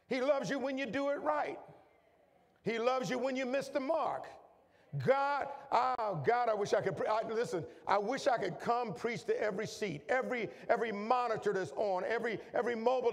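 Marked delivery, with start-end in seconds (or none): none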